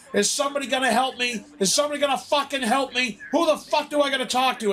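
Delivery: high, exasperated demand for help; mock yelling at everyone within earshot; retold screaming. Demanding help from the whole building, high-pitched mock screaming. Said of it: "I'm screaming in the".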